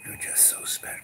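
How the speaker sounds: soft, hushed flattery; creepy whisper. Retold or imitated. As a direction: whisper it close and sly. Demon flattering the speaker.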